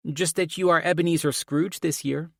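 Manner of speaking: put-on high-pitched cheeky child; sassy daughter. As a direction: needle Dad in a high kid voice. Sassy daughter mocks her dad.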